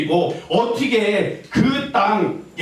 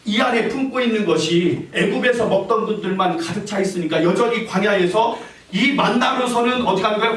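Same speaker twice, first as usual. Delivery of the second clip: normal talking voice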